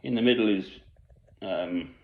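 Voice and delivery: ordinary delivery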